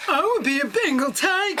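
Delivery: hammed-up put-on voice; imitated contestant voice. Contestant naming her animal, hammed-up imitation. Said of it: "She had a very deep voice".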